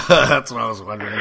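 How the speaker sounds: said through real laughter; laughing. Real, not acted. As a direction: laugh the words out. Laughing at friend's drunk antics.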